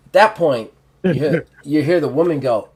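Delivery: normal talking voice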